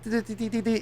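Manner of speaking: high-pitched rapid EMF reader beeps; imitated beeping. Describing ghost gear to a friend, imitated beeping. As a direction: mimic a detector going off in high little dees. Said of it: "like, it's like".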